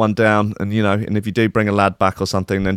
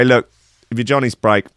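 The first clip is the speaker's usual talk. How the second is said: ordinary talk, nothing marked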